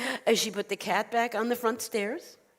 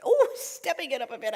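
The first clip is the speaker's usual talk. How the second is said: put-on higher, delighted old woman; eager echo; 5 semitones higher than usual. Old woman takes up the rabbi's advice, eager. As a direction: lift the pitch with a big opening oh.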